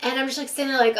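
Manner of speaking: ordinary talk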